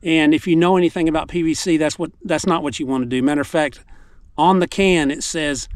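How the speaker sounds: ordinary delivery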